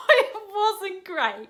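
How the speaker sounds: high, loud, drawn-out laughing wail; wincing laugh. Real, not acted. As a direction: stretch it into a squeal at your own failure. Wincing at own failed accent.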